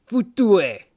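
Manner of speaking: futue barked high and loud; angry curse command. Shown as a demonstration. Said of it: "you would just say".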